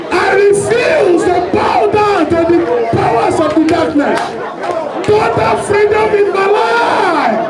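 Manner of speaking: high, forceful declaring prayer. Real, not acted. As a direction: call out freedom with full force. Leads congregation in loud prayer.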